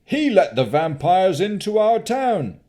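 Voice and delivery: high, loud accusation; angry man. Acted. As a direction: hurl the charge in anger. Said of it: "said an angry man".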